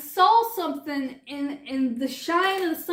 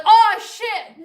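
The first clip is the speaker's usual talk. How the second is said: mock shock; 6 semitones higher, 9 dB louder than usual. Doctors hear about giant mosquitoes.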